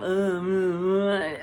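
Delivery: wavering, wordless "uhh" noise; droning groan. Retold or imitated. Imitating getting into judgment of myself, droning groan. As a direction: groan on and on in mock self-judgment.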